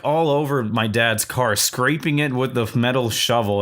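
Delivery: usual speaking voice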